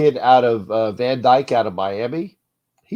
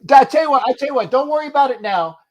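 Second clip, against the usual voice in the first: excited; 11 semitones higher, 3 dB louder, faster than usual. Real, not acted. Urging caller excitedly.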